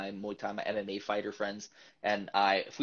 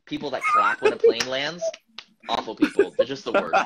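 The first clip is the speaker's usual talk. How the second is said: high, loud, half-laughing rant; excited rant; 9 dB louder than usual. Real, not acted. Host ranting to co-host about plane clappers, high and loud. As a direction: squeal it out in disbelief.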